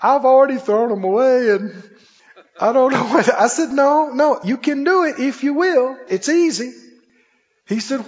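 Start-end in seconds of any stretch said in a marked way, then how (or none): 0.0-3.3 higher, mildly pleading retold protest; retold crying. Smoker crying to the preacher that he has already tried, mock tearful. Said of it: "started crying again". 3.7-6.7 higher-pitched, insistent encouragement; retold insistence. Preacher talking a doubting smoker into it, insistent.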